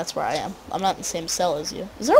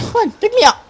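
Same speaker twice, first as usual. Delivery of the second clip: whiny pleading; 10 dB louder than usual. Real, not acted. Begging friend for a ride.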